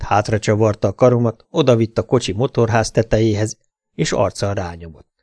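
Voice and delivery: usual speaking voice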